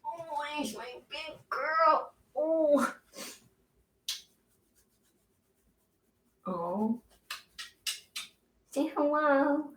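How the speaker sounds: sweet nothings. Real, not acted. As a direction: coo lovingly at the dog. Cooing to her dog.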